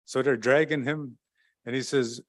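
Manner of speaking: ordinary talk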